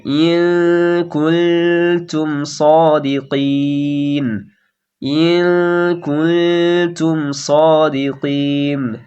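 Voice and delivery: very slow ইন কুলতুম with nasal hum; ghunna demo. Shown as a demonstration. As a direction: hum the ন before ক. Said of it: "নাকে গুন্না করে রাখতে হবে". (ইন কুনতুমে গুন্না)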